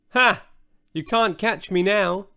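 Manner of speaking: mock whinnying taunt from the unicorn; whinnied. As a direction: toss it off like a horse neighing. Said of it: "it whinnied".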